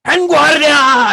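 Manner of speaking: high, loud, drawn-out battle command; drill shout. Acted. Shouted trigger words to trainee hanging on a rope, drill shout. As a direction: bellow it slowly at full force. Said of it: "And you shout".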